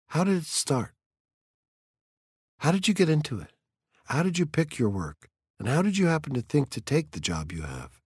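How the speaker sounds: a lightly voiced teenage girl, curious and earnest; curious young girl. A narrator's reading. A girl pressing the fireman about his work, lightly voiced and curious. Clarisse questions Montag, story read aloud.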